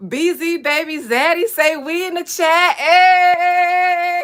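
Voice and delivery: high, loud hype greeting; excited shout-out. Real, not acted. Streamer shouting out a fan in chat, loud and high. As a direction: hype the viewer up loudly.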